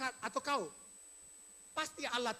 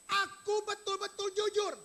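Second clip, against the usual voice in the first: higher, slow insistent plea; Job protesting innocence; 8 semitones higher, 4 dB louder than usual. Acted. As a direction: press the claim with conviction. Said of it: "Ayub mau ngomong begini".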